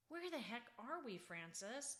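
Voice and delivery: usual speaking voice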